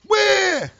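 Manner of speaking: high, shouted mock bawling; hammed-up wailing protest at full volume; tantrum. Rejected guy yelling at the girl, bawling like a baby. Acts out needy guy's tantrum.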